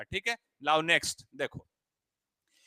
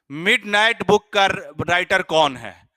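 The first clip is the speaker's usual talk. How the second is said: much louder, slower, calling the class back to the question; 3 semitones higher, 9 dB louder, slower than usual. Real, not acted. Teacher loudly pulls the class back to the question. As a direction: raise the voice to pull students back on topic.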